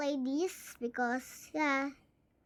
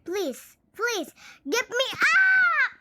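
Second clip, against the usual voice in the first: pleading shout; 9 semitones higher, 10 dB louder, much slower than usual. Real, not acted. Begging in game.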